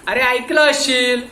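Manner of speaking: loud, high and slow insistence; pressing. Acted. Inspector insisting to the watchman, loud and slow. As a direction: drag it out, insisting.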